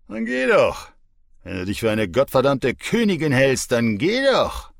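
very high, loud mocking yell; angry shout. Drunk boyfriend shouting as the mother walks out. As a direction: bellow it after her.

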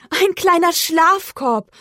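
high, slow, drawn-out cooing; cooing delight. A narrator's reading. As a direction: coo slowly over something cute. Cow coos over tiny basket. (entzückt, hoch und gedehnt)

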